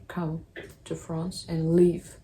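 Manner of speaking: ordinary talk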